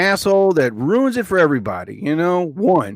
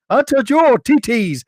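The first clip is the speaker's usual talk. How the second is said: mock high voice; 9 semitones higher, 4 dB louder than usual. Mock voice then laugh.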